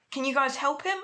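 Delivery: high, rising plea for help; anxious plea. Acted. Deku asking the heroes to help his friend, pleading. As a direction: beg them, voice lifting.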